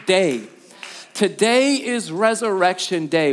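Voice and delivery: rising, emphatic pulpit voice; fervent preaching. Acted. Pastor to congregation, building fervent proclamation. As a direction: proclaim it to the whole room.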